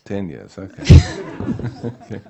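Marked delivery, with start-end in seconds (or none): 0.9-1.8 sudden loud burst of laughter. Real, not acted. Teacher reacting to student's ten years of driving, laughing loudly.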